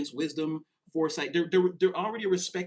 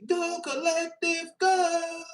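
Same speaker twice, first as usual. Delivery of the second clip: cheer; 11 semitones higher, much slower than usual. Acted. Cheering the viewer on.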